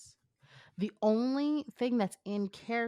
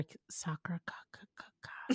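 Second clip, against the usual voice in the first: whispered stumble; 13 dB quieter than usual. Real, not acted. Stumbling on a word.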